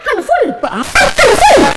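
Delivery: very high, slowed comic shriek; exasperated mum. Retold or imitated. Mum losing it over a handful, exasperated shriek. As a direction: blow it up into a shrieked mock answer. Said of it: "She said".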